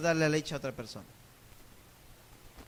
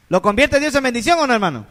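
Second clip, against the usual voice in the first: loud, higher preached question; calling out; 3 semitones higher, 11 dB louder than usual. Real, not acted. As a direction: push the crowd to answer. Preacher demands answer.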